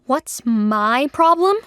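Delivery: slow, loud, furious echo; seething. A narrator's reading. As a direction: spit it back in outrage. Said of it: "Trinity was seething".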